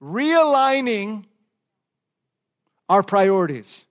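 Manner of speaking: drawn-out title word, syllable by syllable; slow title. Shown as a demonstration. Pastor announces sermon series title slowly. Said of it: "say the subtitle with me".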